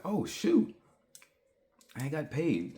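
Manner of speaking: sudden high thrilled squeal; mock delight at payday; excited. Retells happy surprise at payday.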